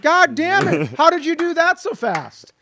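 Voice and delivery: ordinary talk, nothing marked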